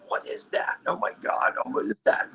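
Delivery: breathy, high-pitched mock freak-out; acted freak-out. Retelling friends' reaction to the secret photo, mock freak-out. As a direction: imitate friends flipping out in a half-voiced squeal. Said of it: "sneaking out like".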